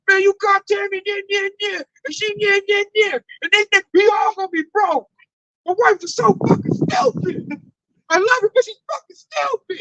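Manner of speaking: hammed-up coworker ranting, near tears. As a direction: mimic him losing it over his wife. Imitating ranting Trump-supporting coworker.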